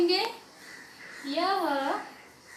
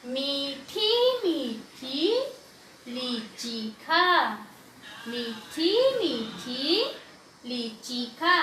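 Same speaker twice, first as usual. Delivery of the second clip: very slow reading, every long vowel held; slow vowel-sign reading; much slower than usual. Shown as a demonstration. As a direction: stretch out each ई and आ for learners. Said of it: "इसे ई की मात्रा ही पढ़ेंगे". (मात्राएं खींचकर धीरे-धीरे पढ़ना)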